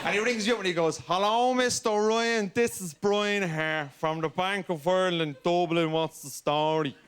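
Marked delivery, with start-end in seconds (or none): 1.1-6.9 slow, put-on Dublin bank caller; slow hammed-up Irish accent. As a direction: lay on a thick Irish accent as the banker. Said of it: "do I need to do another Irish accent on top of the one I'm doing".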